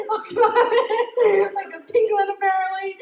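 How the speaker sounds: giggling while describing it; laughing. Real, not acted. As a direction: laugh at your little self. Laughing at picture of herself.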